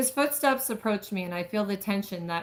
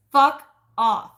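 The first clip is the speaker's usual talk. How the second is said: snapped curse; 3 semitones higher, 8 dB louder than usual. Acted. Woman pushes the man away, loud snap. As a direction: bite the words off loud. She snaps at him again.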